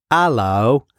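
"hello" as "allo", British accent, no H. Shown as a demonstration. Student recalls professor's phonetic line, British accent. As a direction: drop the H and open with "ah", British. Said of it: "in a, in a British accent".

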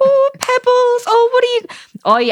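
very high, slow mock coo; baby the dog in a put-on squeal; exaggerated doting pet voice; gushing dog owner. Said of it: "they're like".

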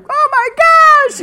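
very high, drawn-out mock squeal; freaking out. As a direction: imitate parents losing it over big news. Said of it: "you know, freaking out".

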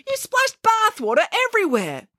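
high-pitched scolding housekeeper; exclaiming. A narrator's reading. Housekeeper finds water all over, exclaiming. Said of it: "exclaimed the housekeeper".

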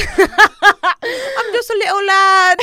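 put-on high, loud, laughing British accent. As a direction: play a giggling British lad. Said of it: "gonna try to be British".

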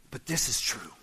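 hushed, breathy whisper; whispered aside. Real, not acted. Preacher confiding to the congregation, in a whisper. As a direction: lean in and whisper the truth.

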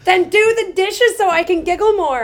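high, loud playful jab; joking demand, all in fun; teasing. Pretend. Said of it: "I'm just kidding".

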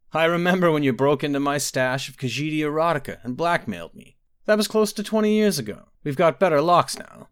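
a hint of gloating amusement; mocking prince. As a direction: Antiochus laughing off his sister's blackmail. Antiochus turns the tables.

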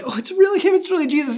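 gawking awe. Retold or imitated. Believer spotting Jesus downtown, awestruck. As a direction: gasp it out like you can't believe your eyes. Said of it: "stand there gawking".

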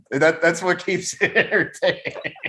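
amused, breaking into laughter mid-sentence; laughing. Real, not acted. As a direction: laugh your way through the remark. Amused banter between hosts.

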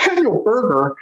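usual speaking voice

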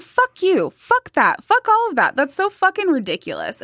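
high-pitched, exasperated rant; fed-up, scornful tirade; indignant outburst. Real, not acted. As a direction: throw each curse out with rising outrage.

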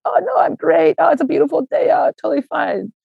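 high, bright fake-cheer brush-off, hiding knee pain; fake cheerful. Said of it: "But externally, I'd be like".

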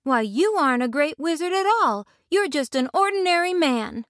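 put-on high-pitched girl's protest; indignant cry. A narrator's reading. Dorothy unmasks the wizard, crying out indignantly. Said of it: "cried Dorothy".

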